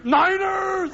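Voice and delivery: high, loud fan shout; Niner fever shout. Retold or imitated. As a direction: yell it like a crazed football fan. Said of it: "Niner fever".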